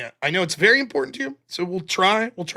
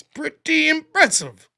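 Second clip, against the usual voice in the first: sarcastic; 8 semitones higher, much slower than usual. Real, not acted. Dry reaction to a superchat joke.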